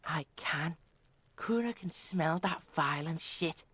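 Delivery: creepy low voice, deliberate; low villain voice. Pretend.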